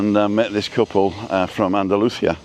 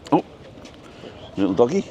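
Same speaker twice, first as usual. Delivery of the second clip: ordinary talk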